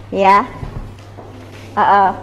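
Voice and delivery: ordinary talk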